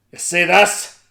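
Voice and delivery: loud booming giant. A narrator's reading. Giant Cucullin showing off to Una, booming. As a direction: boast like a giant.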